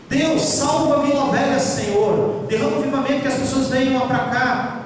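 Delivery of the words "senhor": normal talking voice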